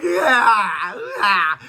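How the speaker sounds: imitating a loud sobbing wail; mock crying wail. Father mimics daughter crying for grandma, mock wailing. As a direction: ham up a crying kid's yeah. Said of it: "and you're crying".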